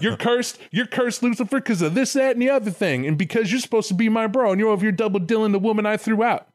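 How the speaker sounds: high, fast-building mock rant; hammed-up exasperated condemnation; cursing God. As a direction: rattle off grievances in indignant high pitch. Acted God cursing Lucifer.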